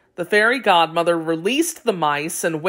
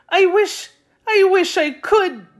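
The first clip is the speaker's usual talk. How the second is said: much higher, louder, breaking through tears; tearful; 12 semitones higher, 4 dB louder than usual. A narrator's reading. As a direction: try to speak but choke up crying. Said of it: "for all her tears and sobbing".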